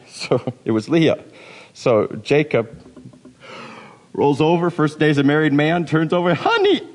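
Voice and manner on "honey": high shrieked call of Honey; mock scream. Groom waking to the wrong bride, shrieking. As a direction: yelp it out in comic horror. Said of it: "Did men scream back then?".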